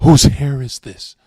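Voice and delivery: loud, drawn-out accusing demand; retold freak-out. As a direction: imitate an angry girlfriend exploding. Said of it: "she freaked out in the car".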